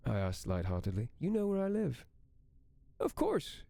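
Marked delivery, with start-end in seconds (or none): 1.2-3.6 Amy's voice. Acted. Narrator voices Amy on phone.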